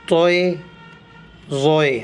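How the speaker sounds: توئے and زوئے said with an o glide, not تا and زا; letter names with oe. Shown as a demonstration. Teacher teaching students توئے زوئے with the oe sound. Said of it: "توئے زوئے پڑھے جائیں گے".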